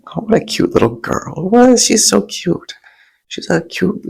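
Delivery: high coo, one squeal on "What?"; cooing at a child. Retold or imitated. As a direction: gush over the cute little girl in a high sing-song. Cooing over a cute little girl.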